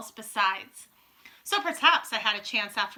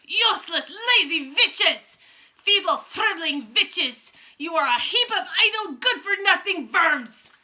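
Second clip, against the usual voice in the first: furious witch tirade; 7 semitones higher, 8 dB louder, slower than usual. A narrator's reading. Witch berating coven.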